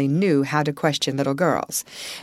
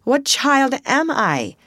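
faltering; 10 semitones higher, 4 dB louder, slower than usual. A narrator's reading. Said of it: "she faltered".

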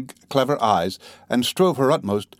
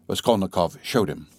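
usual speaking voice

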